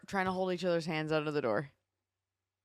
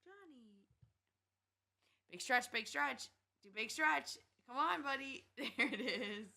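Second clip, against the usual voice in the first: coaxing a pet; 5 semitones higher, 9 dB quieter, slower than usual. Real, not acted. Coaxing the cat to stretch.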